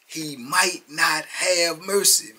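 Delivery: very slow, each word weighed; slow, heavy warning; slow emphasis. Shown as a demonstration. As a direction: drag it out as a grim warning. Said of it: "What do I tell you?".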